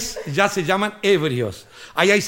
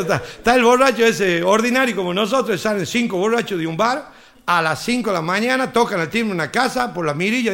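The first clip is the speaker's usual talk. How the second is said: usual speaking voice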